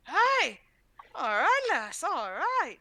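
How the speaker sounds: loud, high delighted cheer, slowing on the last words; excited dwarf cheer. Acted. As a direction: shout your thanks for the gold. Dwarf cheers at golden earring.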